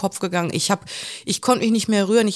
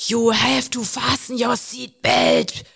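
slow, higher mock-lecturing, word by word; lecturing schoolmaster; 4 semitones higher, much slower than usual. Armed man scolds the passenger like a schoolchild, slow mock lecture. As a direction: imitate a finger-wagging scolding, drawn out. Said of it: "Mit hoch erhobenem Zeigefinger hat er vor mir gestanden".

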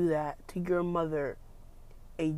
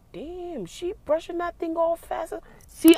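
higher, startled remark; surprised aside; 11 semitones higher, 6 dB louder than usual. Real, not acted. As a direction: suddenly caught by something outside. Distracted by woman outside.

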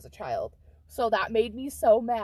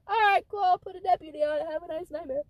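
breezy dismissive; 8 semitones higher, 4 dB louder, faster than usual. Retold or imitated. Dispatcher voiced dismissively.

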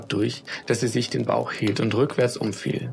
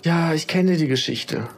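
sighing; 5 semitones higher, 3 dB louder than usual. Acted. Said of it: "seufzte die Mutter".

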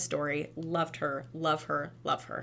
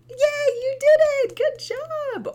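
much higher and louder praise; playful cheer; 11 dB louder, slower than usual. Shown as a demonstration. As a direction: cheer a toddler on. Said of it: "Or like".

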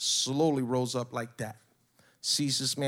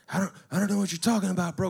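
lightly voiced burnout skater, higher and quick; higher-pitched mock burnout excuse; burnout skateboard kid; 5 semitones higher, faster than usual. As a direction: imitate a stammering skater kid. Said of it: "one of them little burnout skateboard kids".

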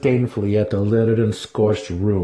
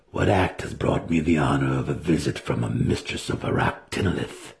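a lower, guarded male voice; lightly voiced wary wizard; 5 semitones lower, 3 dB quieter than usual. A narrator's reading. Wizard questioning the priestess, low and guarded. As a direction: keep it low and careful, a hint of nerves.

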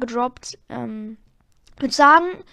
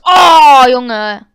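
shocked shout; 14 dB louder than usual. Real, not acted. Sudden gameplay shock.

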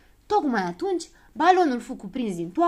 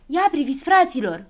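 louder, higher call to the group; excited shout; 6 semitones higher, 8 dB louder than usual. A narrator's reading. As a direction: call out to your friends. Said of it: "începu să strige Habar n-am".

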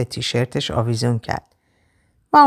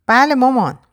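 put-on high child's voice; little boy; 9 semitones higher, 4 dB louder than usual. A narrator's reading. Boy answering his mother, high child voice. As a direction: answer in a small boy's high pitch. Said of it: "پسر بچهٔ هفت هشت سالهٔ توپلی".

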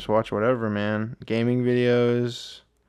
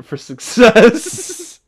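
high and slow, hammed-up; high, drawn-out squeal; 12 semitones higher, 9 dB louder, much slower than usual. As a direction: stretch it out in a high silly voice. Jokey boast about secrets.

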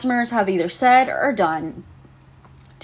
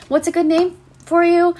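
much higher, bright friendly staff voice; chirpy fitting-room attendant; 10 semitones higher, 4 dB louder than usual. Retold or imitated. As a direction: sing it out like a cheerful attendant. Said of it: "and she's like".

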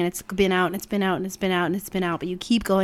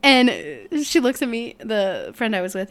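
ordinary talk

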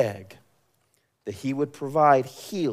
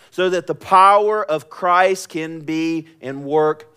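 raised, ringing sermon voice; forceful scripture preaching; 6 semitones higher, 8 dB louder than usual. Acted. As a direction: proclaim the verse high and loud, stretching key words.

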